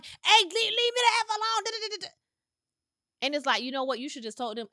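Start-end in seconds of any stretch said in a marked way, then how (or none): 0.0-2.1 mock yell. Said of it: "woman calling".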